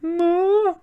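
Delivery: high, drawn-out creature moo; Pokemon cry. Acted. Kicked Pokemon crying out, high creature voice. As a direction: squeal a high little creature call.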